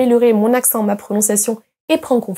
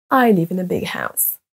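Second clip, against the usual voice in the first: "in a" reduced and linked, key words standing out; natural English intonation; 3 semitones lower than usual. Shown as a demonstration. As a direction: let "live", "big", "house" carry the sentence. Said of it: "plus fort et plus longtemps certains mots".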